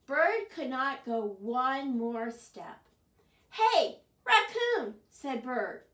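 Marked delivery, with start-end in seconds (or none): 3.5-5.0 high, loud urgent hail; calling out. Acted. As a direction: shout over to a friend. Bird calls out to Raccoon.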